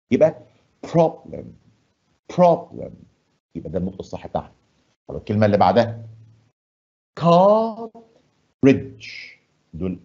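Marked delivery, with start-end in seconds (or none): 0.1-2.9 "problem" with PRO said strong and high, lem softened; stress on PRO. Shown as a demonstration. Teacher to pupils, showing where the stress goes in "problem". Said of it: "أما الجزء التاني أحاول أخفيه شوية". 7.2-9.1 "cartridge" with CAR high and strong; stress on first syllable. Shown as a demonstration. Teacher shows pupils the stress in "cartridge". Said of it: "المقطع الأولاني هو إيه؟ أقوى".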